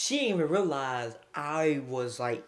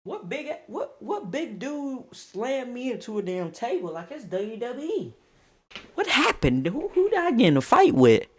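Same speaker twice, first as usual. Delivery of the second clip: confused; 8 semitones higher, 4 dB louder than usual. Retold or imitated. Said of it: "When I come to".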